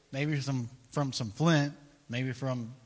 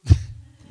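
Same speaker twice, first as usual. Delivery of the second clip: mocking laugh; 12 dB louder than usual. King scoffing at their God.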